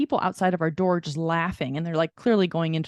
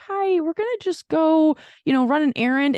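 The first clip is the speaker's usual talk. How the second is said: fake casual excuse; 9 semitones higher, 4 dB louder than usual. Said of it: "I was like".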